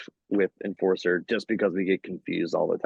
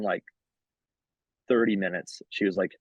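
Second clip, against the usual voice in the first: usual speaking voice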